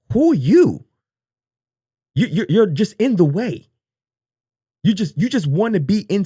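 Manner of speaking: shouting in disbelief; loud disbelief. Real, not acted. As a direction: yell it, high and incredulous. Incredulous outburst at clip.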